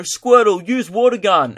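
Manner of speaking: high, punchy playful order; calling a command. Retold or imitated. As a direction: call it out like a Pokemon trainer. Acts out commanding turtle as Pokemon.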